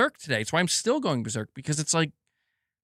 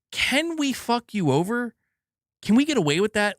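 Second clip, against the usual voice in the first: higher, sly mock executive voice; mocking shady exec; 7 semitones higher than usual. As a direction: play the scheming company man, pitched up. Mocking greedy company mentality.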